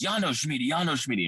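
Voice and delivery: put-on retelling the man sending dogs after kids, calling it out; imitated command call. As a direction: bark out the name like a handler. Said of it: "And then he'd say".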